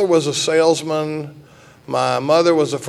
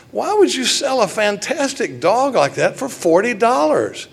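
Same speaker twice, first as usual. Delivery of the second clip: incredulous; 8 semitones higher than usual. Acted. Acted incredulous quote in joke.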